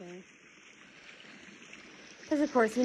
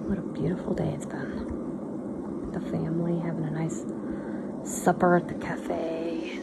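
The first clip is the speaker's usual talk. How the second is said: quiet at night; 8 dB quieter than usual. Real, not acted. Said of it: "I'm talking kinda quiet so I don't wake up the kiddos".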